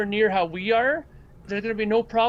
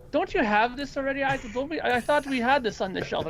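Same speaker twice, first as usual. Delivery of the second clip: higher-pitched mock protest; imitating girlfriend; 6 semitones higher than usual. Girlfriend questions another purchase, imitated higher-pitched protest. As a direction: act out a puzzled girlfriend, voice lifted. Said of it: "my girlfriend at the time, she was like".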